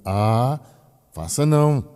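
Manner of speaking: put-on a mother telling the child no; mother's scolding tone. Mother warning her small child not to, firm tone. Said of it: "pela entonação da mãe".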